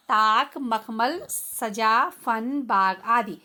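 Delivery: ताक, मखमल, सजा, फन, बाग said very slowly for the class; slow demo. Shown as a demonstration. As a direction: dictate each example word slowly. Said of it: "जैसे उदाहरण है बच्चों".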